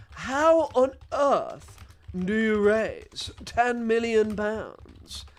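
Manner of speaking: high, very slow royal caricature; the king. Acted. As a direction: drag out each word in a mock-regal voice. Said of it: "That's how the king talks".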